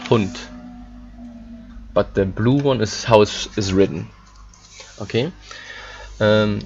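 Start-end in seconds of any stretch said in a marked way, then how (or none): 0.1-0.4 the final D of Hund voiced as T. Shown as a demonstration. Teacher to class, showing final D sounds as T. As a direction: say Hund ending on a hard T, "Hunt". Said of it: "the red one is how it's pronounced".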